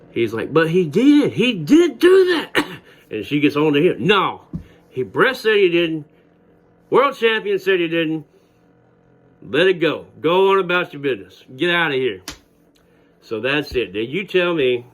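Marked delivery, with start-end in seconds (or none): 0.5-2.7 very high desperate whine, tattling; whiny kid pleading. Retold or imitated. Bully protesting again. 4.1-12.2 higher, brisk mock teacher telling off a kid; teacher scolding.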